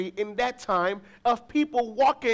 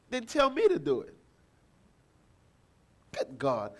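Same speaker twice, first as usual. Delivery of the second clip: normal talking voice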